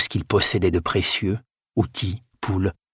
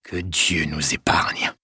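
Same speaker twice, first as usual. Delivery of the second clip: slow, lower-pitched prayer in dread; 4 semitones lower, 4 dB quieter, slower than usual. A narrator's reading. Prior fearing the Viking raid, slow dread. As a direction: draw it out, appalled. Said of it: "laissa place à l'effroi".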